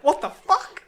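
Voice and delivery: high, loud startled what the fuck; mate hit by a flying bag, shocked cry; shocked outburst. Retold or imitated. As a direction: blurt it out, hit in the head, half laughing. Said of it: "and he's like".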